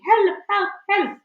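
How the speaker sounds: high, loud cries for help; mock yell. A narrator's reading. Witch mimics panicking teachers, high mock yell.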